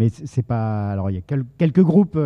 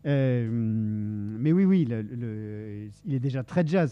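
frog in throat; 9 dB quieter than usual. Real, not acted. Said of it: "j'ai un chat dans la gorge".

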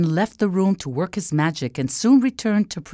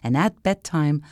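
ordinary delivery

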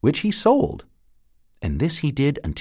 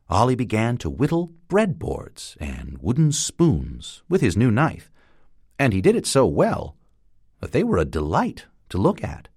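usual speaking voice

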